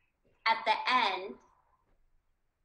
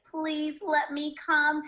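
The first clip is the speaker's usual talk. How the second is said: high, drawn-out plea; pleading character; 6 semitones higher, 4 dB louder, slower than usual. Acted. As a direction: beg slowly in a raised voice. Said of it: "Then Iris was like".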